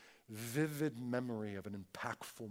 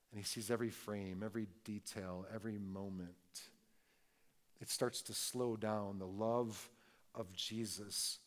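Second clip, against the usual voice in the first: soft, lower, slowing down; tender hush; 5 semitones lower, 7 dB quieter than usual. Real, not acted. Preacher softly reflecting on John's memory of Jesus, tender. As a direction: linger on each moment gently.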